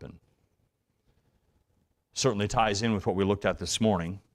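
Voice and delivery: ordinary talk, nothing marked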